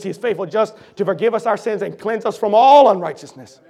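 ordinary talk, nothing marked

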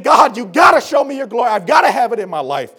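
loud, high cry to God; desperate plea. Retold or imitated. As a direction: shout the longing. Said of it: "He's saying".

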